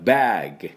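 バッグ as English "bag" with a throaty final g; American bag. Shown as a demonstration. Teacher demonstrating bag for learners, バッグ with audible throaty g. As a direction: let the g of バッグ sound in the throat, no "u". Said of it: "喉の音を相手に聞かせます".

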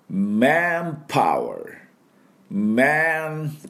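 manpower very slow, in English; teacher modelling manpower slowly. Shown as a demonstration. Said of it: "どんな感じかというと".

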